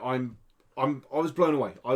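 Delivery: ordinary talk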